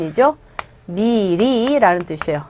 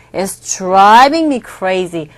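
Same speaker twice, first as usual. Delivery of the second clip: very slow model line; slow demo read; 3 semitones lower, much slower than usual. Shown as a demonstration. Teacher modelling the sentence slowly. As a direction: draw it out for learners. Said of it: "drive someone crazy".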